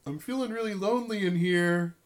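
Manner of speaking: lilting needy call from the tub; lonely man calling out. Acted. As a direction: call through the door, wanting company. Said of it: "he says".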